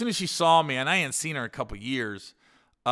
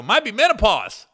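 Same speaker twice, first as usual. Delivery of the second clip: put-on drunk wisecrack; 7 semitones higher, 7 dB louder than usual. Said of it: "and I go".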